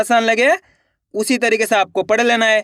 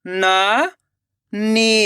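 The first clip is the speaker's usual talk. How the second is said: नानी split into ना, long pause, नी; rising ना, then नी alone; syllable by syllable; much slower than usual. Shown as a demonstration. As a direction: sound out नानी very slowly in two parts. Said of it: "एक एक अक्षर का उच्चारण करना है".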